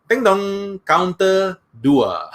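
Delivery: sing-song ding dong, then slow counter call; playful announcer call; mock queue call. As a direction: chime it like a bank queue machine. Queue number call chime.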